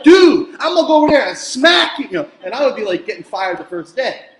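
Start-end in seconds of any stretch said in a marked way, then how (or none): 0.0-2.1 high, very loud threat at a student; shouting teacher losing it, half-joking; mock angry yell. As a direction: blow up at the class, hammed-up.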